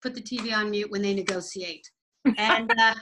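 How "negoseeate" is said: "negotiate" with S in the middle; CBC negotiate. Retold or imitated. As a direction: say "negotiate" as "ne-go-see-ate". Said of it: "they negotiate".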